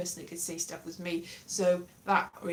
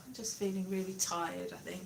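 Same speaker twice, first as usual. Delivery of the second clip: ordinary talk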